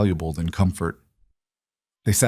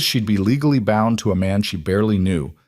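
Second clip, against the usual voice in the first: ordinary delivery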